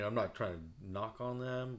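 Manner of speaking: ordinary talk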